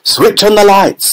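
high commanding call; shouted. A narrator's reading. Mister Wonka ordering the lights on, shouting. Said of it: "shouted Mister Wonka".

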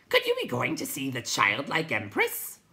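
loud, high creature voice; hooting creature. A narrator's reading. Night Hob asks the Will-o'-the-Wisp, loud high voice.

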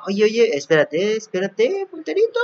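high-pitched comic plea to wait; playful calling out. Real, not acted. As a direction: call to the runaway pointer, climbing high. Calling after a runaway cursor.

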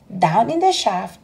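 ordinary delivery

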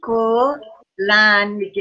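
کلنگ drawn out extremely slowly; slow dictation. Shown as a demonstration. Teacher dictating the last word to the class, very slowly. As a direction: stretch every sound for the writers.

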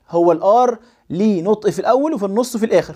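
usual speaking voice

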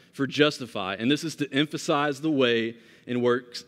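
ordinary delivery

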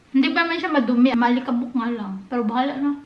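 talking while chewing fruit; speaking between bites; mouth full of watermelon. Real, not acted. Seen 2: bites at -1, then speaks holding slice, lips pressed, chewing.

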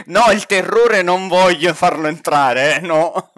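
loud refusal through laughter; laughing protest. Real, not acted. Player refuses to let the terror in, laughing. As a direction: protest loudly while cracking up.

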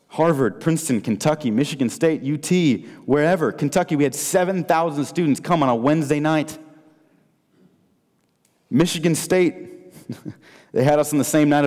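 rallying the crowd with each campus name; rising excitement. Real, not acted. Preacher firing up the students, listing packed-out campuses excitedly.

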